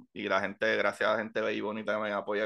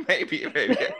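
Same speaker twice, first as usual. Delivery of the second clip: high laughing maybe, doubtful; 12 semitones higher than usual. Real, not acted. Host laughs at guest's wild theory. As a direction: giggle the maybe out, teasing.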